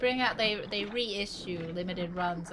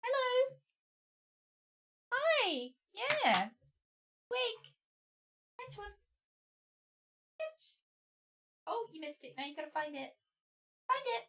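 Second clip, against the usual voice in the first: talking to a dog; 13 semitones higher, 5 dB quieter than usual. Real, not acted. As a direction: coo at the dog gently. Talking sweetly to her dog.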